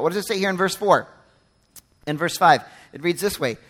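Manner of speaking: ordinary talk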